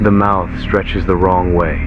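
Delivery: usual speaking voice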